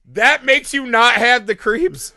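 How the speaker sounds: incredulous question breaking into laughter; laughing disbelief. Retold or imitated. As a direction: laugh at how absurd it is.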